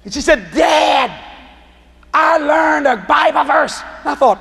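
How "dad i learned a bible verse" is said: drawn-out, higher, imitating a proud child; excited little daughter. Little girl back from church telling Dad her news, proudly imitated. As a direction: play a child announcing big news, slowly.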